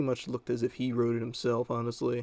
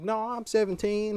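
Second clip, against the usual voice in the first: high-pitched mock-child insistence; imitated kid; 10 semitones higher, 3 dB louder than usual. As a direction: put on a kid's high protest. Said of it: "he was like".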